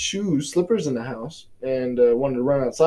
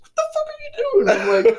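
shrill-pitched mock of wife, much higher; 4 dB louder than usual. Wife finding him doing the dishes, in high mock voice. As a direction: squeal it out in a parody of the wife. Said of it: "she's like".

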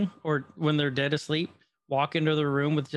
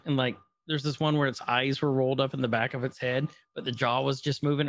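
usual speaking voice

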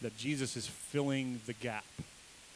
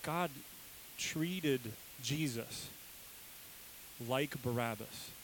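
slow emphasis; 5 dB quieter, much slower than usual. Real, not acted. Preacher's closing point.

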